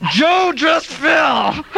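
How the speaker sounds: man behind the curtain calling out through clenched teeth. Acted. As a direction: yell it through a jaw that won't open. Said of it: "a guy with his teeth clenched like this".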